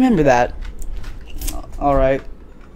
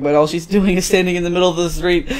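ordinary delivery

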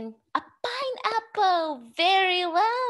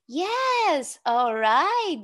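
ordinary delivery

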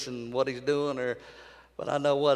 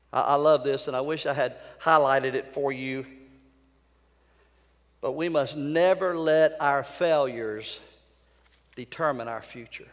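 normal talking voice

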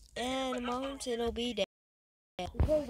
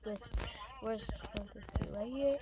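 ordinary talk, nothing marked

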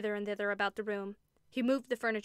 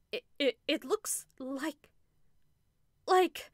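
horrified stammer; 5 semitones higher, 4 dB louder, slower than usual. A narrator's reading. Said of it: "stammered Missus Brigham in a tone of intense horror".

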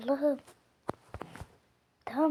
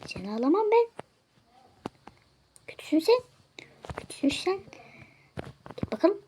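mock stern refusal; 7 semitones higher, faster than usual. Bouncer rejecting a customer, raised mock-stern voice. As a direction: shoo him off with a raised voice.

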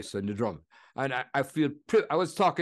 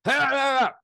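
much higher, louder barking yells; imitating an angry shout; 12 semitones higher, 5 dB louder than usual. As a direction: shout it at the visitor in a temper. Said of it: "he started shouting at the guy".

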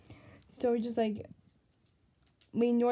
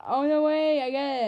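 sudden realization; 4 semitones higher, 6 dB louder, slower than usual. Real, not acted. Suddenly getting the joke.

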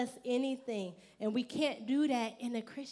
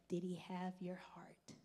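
hushed whisper, barely voiced; 5 semitones lower, 11 dB quieter than usual. Real, not acted. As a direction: lean in and whisper the question. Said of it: "whispered sermon question".

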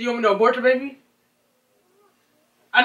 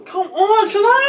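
high, raised-voice protest; real quarrel, voice pushed up; exasperated plea; 8 semitones higher, slower than usual. Real, not acted. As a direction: plead with them to ease up, pitch climbing. Pleading back during the quarrel.

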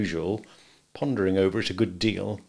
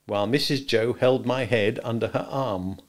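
plain reading voice

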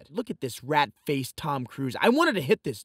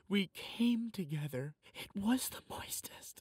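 hushed; 6 semitones higher, 8 dB quieter than usual. Acted. Poem ending hushed.

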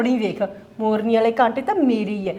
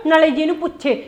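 ordinary talk, nothing marked

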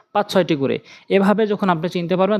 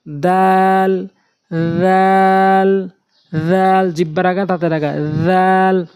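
slow, drawn-out letter names for learners; careful tajweed letter drill; Quran letter recitation; 3 dB louder, much slower than usual. Shown as a demonstration. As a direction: pronounce each Arabic letter carefully. Teacher recites Arabic letter names.

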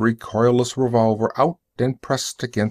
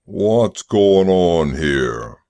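slow, low boom; 4 semitones lower, 4 dB louder, much slower than usual. Acted. Driver breaking into the crowd, slow booming voice. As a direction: boom it out slowly to command attention. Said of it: "It was a booming voice".

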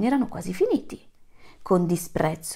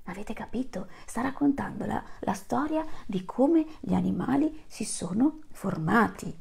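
voiceless whisper to the listeners; whispered aside; 2 semitones higher, 6 dB quieter than usual. Real, not acted. Storyteller whispers an explanation to the children. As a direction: share the secret in a whisper.

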